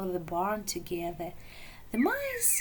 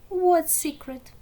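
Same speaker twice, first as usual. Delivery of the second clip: loud, high excited ask; curious cat eager for the secret; eager question; 8 semitones higher, 7 dB louder than usual. Acted. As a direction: pounce on the word secret. Clementine eager.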